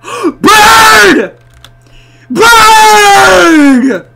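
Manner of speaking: high, loud hammed-up wail; drawn-out scream. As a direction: scream it long at the sight. Screams on seeing the bird.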